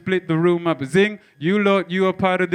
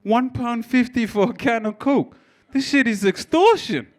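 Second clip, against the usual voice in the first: outraged at the price; 7 semitones higher than usual. Retold or imitated. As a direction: squeal at the price of a can of Coke. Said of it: "you're like".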